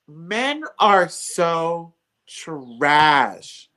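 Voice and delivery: very slow, drawn-out verdict; emphatic disgust. Real, not acted. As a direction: stretch out each word in exasperation.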